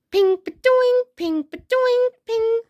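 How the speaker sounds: high, loud playful boings off the shield; shield sound effects. A narrator's reading. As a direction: make the arrows ping off the shield. Arrows bouncing off shield.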